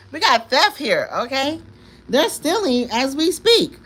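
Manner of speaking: loud, high alarm about being robbed. Real, not acted. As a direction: call out the theft for everyone to hear. Announcing theft on stream.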